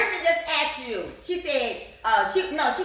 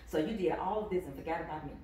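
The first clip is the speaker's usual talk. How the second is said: suddenly hushed, near whisper; inside voice; 8 semitones lower, 7 dB quieter than usual. Real, not acted. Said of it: "So let me get in my inside voice".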